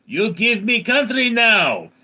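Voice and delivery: put-on loud, high demand in a Russian accent; Vladimir Putin. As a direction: bark it like a cartoon Russian strongman. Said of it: "Vladimir Putin goes up to Ukraine and says".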